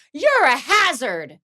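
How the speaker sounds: high, slow mocking yell; a snooty patroller yelling at a skier. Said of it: "just yelled at me".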